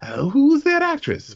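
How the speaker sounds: high, squinting "who is that" voice; high, puzzled guessing at the screen; puzzled mock double take. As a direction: mimic straining to place a face. Confused viewer spotting actress.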